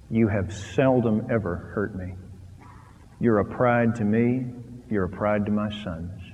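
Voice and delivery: softly voiced tender words to his wife; quiet, tender retelling. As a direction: say it gently, close to tears. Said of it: "I wept".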